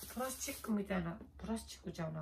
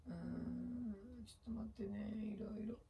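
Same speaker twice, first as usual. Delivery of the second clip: whispered aside to herself; 3 semitones higher, 10 dB quieter than usual. Real, not acted. Late-night vlogger stalling viewers, whispering. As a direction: breathe it out while busy.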